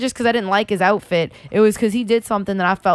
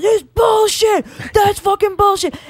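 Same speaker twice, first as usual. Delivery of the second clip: high, drawn-out heckle from the crowd; retold yelling; 11 semitones higher, slower than usual. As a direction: re-enact yelling in outrage at a bad call. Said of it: "so I was yelling".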